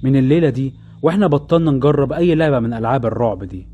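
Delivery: ordinary delivery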